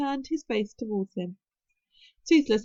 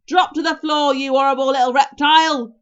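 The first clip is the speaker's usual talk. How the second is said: booming, higher-pitched sustained shout; yell; 3 semitones higher, 12 dB louder than usual. A narrator's reading. Stoic ordering Toothless to the floor, yelling. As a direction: bellow the order without letting up. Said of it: "yelled Stoic".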